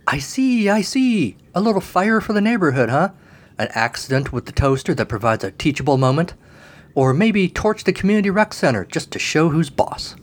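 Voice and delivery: high, oily salesman voice, eager to please; eager fire salesman. A narrator's reading. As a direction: sell it like a pushy demon clerk. Felipe sells fire, eager.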